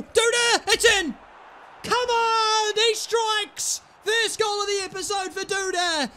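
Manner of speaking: high, loud triumphant yell; goal celebration. Real, not acted. Gamer celebrating his first goal of the episode, shouting. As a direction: roar it like a goal call.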